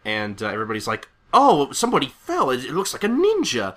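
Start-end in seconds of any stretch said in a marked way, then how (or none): 1.3-3.8 high-pitched surprised exclamation; astonished onlookers. Acted. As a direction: react like a startled crowd. Crowd reacts to fall.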